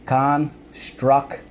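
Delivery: stretched slowly, in a different-sounding voice; slow demonstration of "Construction"; slow model word. Shown as a demonstration. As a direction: say "Construction" slowly for the class to echo. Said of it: "full of construct".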